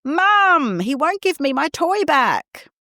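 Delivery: put-on child shouting. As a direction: call out to Mum like an upset child.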